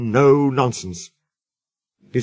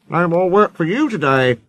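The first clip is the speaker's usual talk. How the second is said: controller voice; 5 semitones higher than usual. A narrator's reading. Thin controller speaking.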